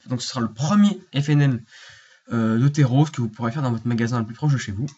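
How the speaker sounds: ordinary delivery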